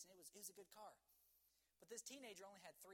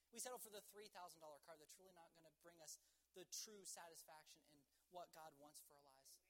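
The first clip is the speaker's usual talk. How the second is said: ordinary talk, nothing marked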